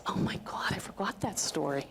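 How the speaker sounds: hushed, breathless whisper of surprise; barely voiced, as if memory just came back; mom whispering. Mom whispering to her daughter at the door, surprised. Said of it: "my mom goes".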